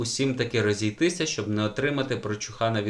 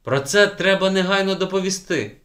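higher and louder, urgent indignation; indignant; 8 semitones higher, 6 dB louder than usual. A narrator's reading. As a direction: insist it must be reported now. Outraged teacher. (обурено й рішуче)

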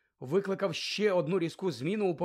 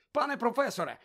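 high, eager call for attention; hurrying to speak first; 7 semitones higher than usual. A narrator's reading. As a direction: cut in urgently before another can. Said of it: "поспішив заговорити раніше". (квапливо, схвильовано)

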